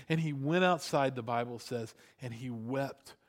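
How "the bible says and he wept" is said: ordinary talk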